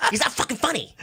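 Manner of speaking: high scornful jab, laughing through it; laughing mock Rogan put-down; mocking Joe Rogan. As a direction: laugh while sneering the insult up high. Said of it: "Joe Rogan says".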